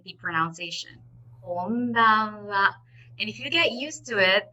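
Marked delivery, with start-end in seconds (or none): 1.4-2.7 "konbanwa" drawn out very slowly; slow practice. Shown as a demonstration. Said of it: "slowly first".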